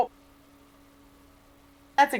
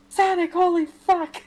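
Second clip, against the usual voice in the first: higher, drawn-out mock outburst; mock exclamation; 7 semitones higher, 3 dB louder than usual. Reading storyboard lines aloud to a friend, mock exclamation. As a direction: exclaim it slowly, pitched up, for laughs.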